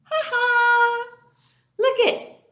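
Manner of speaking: high, loud puppet bear laughing; Berry Bear puppet voice. Acted. Teacher voicing Berry Bear for kids, high and cheerful. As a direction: squeak it like a happy toy bear.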